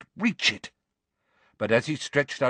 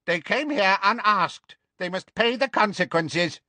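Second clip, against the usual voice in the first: high-pitched accusing shout; angry shout; 8 semitones higher than usual. Acted. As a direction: shout it from the back of a crowd. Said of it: "A bearded centaur ... shouted".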